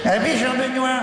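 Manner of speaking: put-on high, shaky-free old cardinal voice; old man voice. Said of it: "j'ai qu'une voix de vieux".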